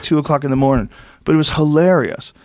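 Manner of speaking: ordinary talk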